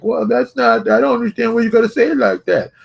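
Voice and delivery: high-pitched mock complaint; whiny objector. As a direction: imitate an offended complainer in a high voice. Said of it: "some people who are like".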